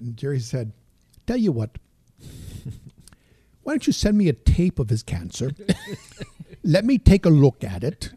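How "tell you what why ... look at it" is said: put-on loud comic Indian accent; louder Indian accent with laughs breaking in. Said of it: "If I do an Indian accent".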